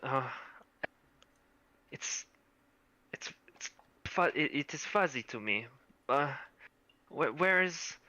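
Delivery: put-on halting, lightly Russian-accented stammer; confused Russian girl. Dazed girl recalls her lost group, light Russian accent. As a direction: stammer through hazy memories. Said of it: "I have to do a Russian accent".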